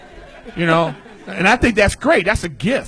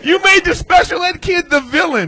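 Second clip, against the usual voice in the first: loud, much higher excited cheer; delighted praise; 11 semitones higher, 7 dB louder than usual. Real, not acted. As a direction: rave about the joke.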